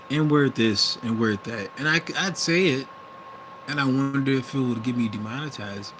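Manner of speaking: ordinary delivery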